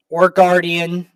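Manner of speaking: ordinary talk, nothing marked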